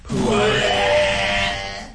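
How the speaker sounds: loud echoed pua. Retold or imitated. Echoing the noise loudly.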